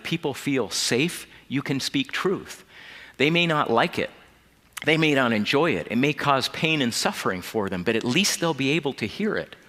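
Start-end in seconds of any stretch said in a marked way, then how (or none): none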